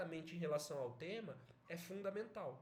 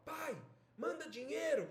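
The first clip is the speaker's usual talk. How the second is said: loud, high-pitched order; demanding; 9 semitones higher, 5 dB louder than usual. Acted. Irritated father reading his son's telegram aloud, demanding. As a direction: read it out as an outrageous demand. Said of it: "é uma imposição".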